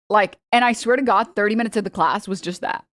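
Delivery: ordinary delivery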